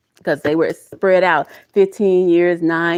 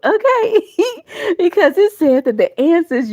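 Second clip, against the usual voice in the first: higher okay breaking into a laugh; laughing delight; 9 semitones higher, 3 dB louder than usual. Real, not acted. Reader thrilled by the card, laughing. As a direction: laugh with relief.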